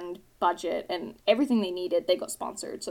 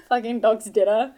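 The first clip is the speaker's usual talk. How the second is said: put-on British accent; 6 dB louder than usual. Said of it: "this fake British human".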